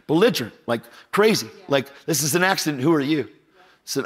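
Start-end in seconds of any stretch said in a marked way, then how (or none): none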